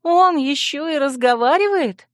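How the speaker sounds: high-pitched, incredulous question; appalled astonishment. Acted. Princess discovers the yawning skull can talk, appalled. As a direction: voice shoots up in disbelief.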